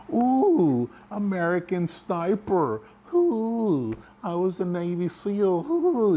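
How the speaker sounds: high, drawn-out mock-awed "ooh"; mocking taunt. Soldier ripping into his buddy to make everybody laugh, mocking. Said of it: "ripping into him really hard".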